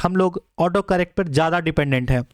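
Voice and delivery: usual speaking voice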